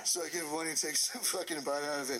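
ordinary delivery

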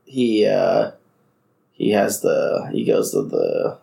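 drowsy, slow and low; fading into sleep mid-sentence; sleepy. Real, not acted. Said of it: "I'm falling asleep".

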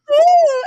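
very high, loud offended squeal; huffy ooh. Retold or imitated. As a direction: storm off with a shriek. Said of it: "And she's like".

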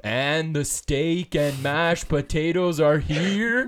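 mock broadcast call rising to a big finish; Kevin Harlan. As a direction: announce it like a huge play, very drawn out. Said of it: "talk like he talks on a broadcast".

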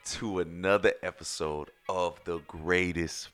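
deep booming announcer voice, hyping the show; grand entrance announcement. Acted. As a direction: welcome the world like a big-stage announcer. Host opens show over music.

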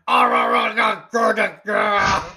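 loud, high wordless roaring; Andre grunting. Retold or imitated. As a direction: bellow nonsense like a giant wrestler. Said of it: "He always goes".

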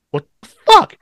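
high-pitched, incredulous outburst; baffled disbelief. Retold or imitated. Driver hearing the same bird noise return, high baffled outburst. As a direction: let the pitch leap up in disbelief. Retold bafflement at repeated chirping.

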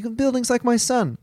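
high mock Italian accent.

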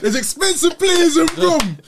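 high and slow through a laugh. Real, not acted. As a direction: laugh it out, drawn out. Laughs then repeats louder.

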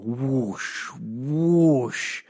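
long swishing sound effect; imitated whoosh. Dad walks along unwinding elastic, imitated whooshes. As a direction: draw out each whoosh. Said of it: "he just walks along".

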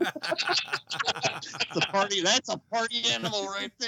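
loud, high, drawn-out whoop of a line; party-animal shout. Real, not acted. Cheering the party animal to the room, exuberant shout.

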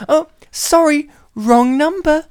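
high, loud mock secretary; squeaky assistant voice; apologetic secretary. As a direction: sing out a put-on apology. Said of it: "And then she goes".

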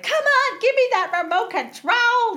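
high, loud pleading; mock worried plea. Retelling the movie, Dale pleads with the Hawk guy, high loud mock plea. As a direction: beg for the remote, high and loud. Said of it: "Dale is like".

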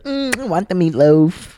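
lightly voiced imitation of Jackie; imitating Jackie. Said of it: "Jackie's like".

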